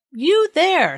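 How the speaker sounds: high-pitched, commanding hail; single someone out with a call. A narrator's reading. King calling to the tall bearded man, commanding.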